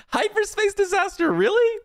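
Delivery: high, laughing, incredulous question; laughing disbelief. Real, not acted. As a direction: laugh it out, can't believe it. Laughing at opponent's card.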